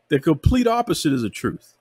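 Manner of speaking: usual speaking voice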